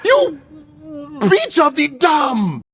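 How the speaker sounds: high, stretched-out mock outburst; hammed-up angry mom shriek; frustrated mom. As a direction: blurt a botched insult, high and slow. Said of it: "she was frustrated and didn't know any other way to insult".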